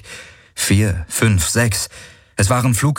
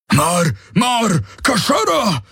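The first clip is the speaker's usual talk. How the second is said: alien shouting; 13 semitones higher, slower than usual. Acted.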